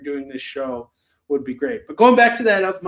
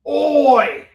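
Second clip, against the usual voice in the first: loud Australian shout of Oi; 8 semitones higher, 8 dB louder than usual. Pretend. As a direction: bark a broad Australian Oi. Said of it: "pulling out my Australian accent".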